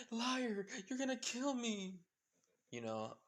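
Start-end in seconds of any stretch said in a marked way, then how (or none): 0.1-2.0 high, loud frantic accusation; paranoid panic. Acted. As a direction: shout it in paranoid fear. Paranoid guy accuses Izuku.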